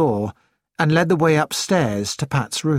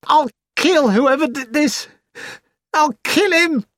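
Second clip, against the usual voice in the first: furious; 12 semitones higher than usual. A narrator's reading. Vows revenge over the body.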